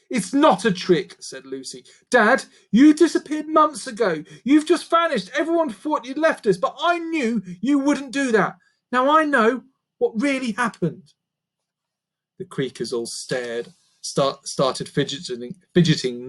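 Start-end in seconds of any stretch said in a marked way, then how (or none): 0.1-11.1 put-on much higher young girl's voice, urgent; pleading girl. A narrator's reading. Daughter pleads with her changed dad, higher girl's voice. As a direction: beg him to know you.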